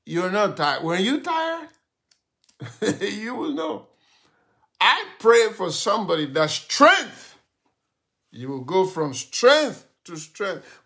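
loud, high, driving sermon; fiery preaching. Real, not acted. Pastor preaching to a weary believer, full force.

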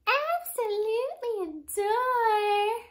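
very high, slow villain voice; movie villain. Retold or imitated. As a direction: camp it up like a cartoon villain. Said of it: "The villain in that, she was like".

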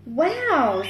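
usual speaking voice

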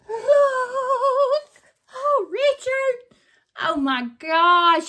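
loud, high, half-crying delight; overwhelmed. Real, not acted. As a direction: gush between laughter and tears. Overcome by the signed gift.

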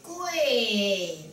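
跪 held long, slowly falling; drawn-out call. Shown as a demonstration. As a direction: stretch 跪 like a temple officiant's command. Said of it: "都拉长音". (拉长音喊“跪”)

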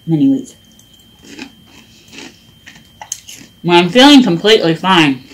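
chewing between words; slightly muffled chewed speech; mouth full of cereal. Real, not acted. Seen 2: Spoon goes to mouth at 2.2s; she eats during the later words.

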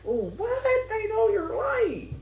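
high, drawn-out disbelief; incredulous. Real, not acted. Reacting to the story, a high incredulous what. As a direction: act floored by it.

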